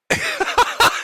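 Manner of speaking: squealing laugh; amused high laugh; high burst of laughter. Real, not acted. Laughs at Ralph's rant.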